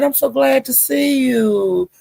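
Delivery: high, drawn-out gushing welcome; slow, high and delighted to see them; excited greeting. Retold or imitated. Lolo greeting a friend in chat, high drawn-out gushing. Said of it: "And she said".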